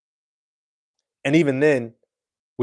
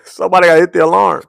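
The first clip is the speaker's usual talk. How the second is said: higher, louder, laughing through it; 7 semitones higher, 7 dB louder, faster than usual. Real, not acted. Host laughing at his own driving joke, higher and louder. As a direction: crack up at the bit.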